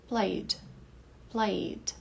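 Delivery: Played with the E dropped, ending plaid; Played said as one syllable. Shown as a demonstration. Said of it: "veja que esse E caiu fora na pronúncia".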